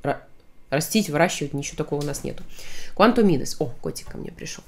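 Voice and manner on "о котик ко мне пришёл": soft aside. Real, not acted. Cat arrives, aside.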